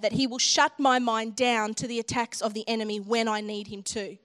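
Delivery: ordinary talk, nothing marked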